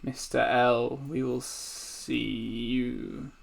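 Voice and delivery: slow, drawn-out farewell; creepy, unhurried villain parting; sinister sisters. Acted. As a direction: stretch the last words out ominously. Sisters' ominous parting words.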